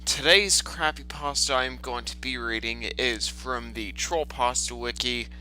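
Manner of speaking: normal talking voice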